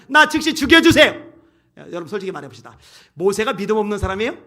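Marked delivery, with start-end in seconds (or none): 0.1-1.1 high, loud acted begging; desperate plea. Preacher as Moses begging God for death, desperate shout. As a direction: cry it out as a desperate demand.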